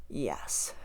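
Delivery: a quiet thrilled gasp of delight; breathless yes. Retold or imitated. Revisiting the favorite song, hushed delighted gasp. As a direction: gasp then a soft, low, hushed yes.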